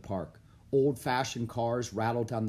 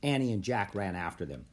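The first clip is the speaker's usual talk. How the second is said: ordinary delivery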